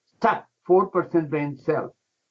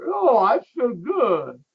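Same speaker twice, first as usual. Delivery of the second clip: slow relieved delight; 6 semitones higher, slower than usual. Retold or imitated. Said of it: "Within one hour".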